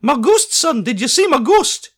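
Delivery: high, loud eager Scottish artist; eager high Scottish painter asking the boy about his ghost. A narrator's reading. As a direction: ask it eagerly in a high Highland voice. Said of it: "unusually high-pitched voice".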